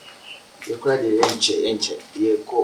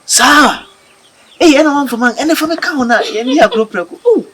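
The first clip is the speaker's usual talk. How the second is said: high, loud excited exclamation; excited outburst; 13 semitones higher, 8 dB louder than usual. Acted. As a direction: burst out in excitement. Excited outburst about friend.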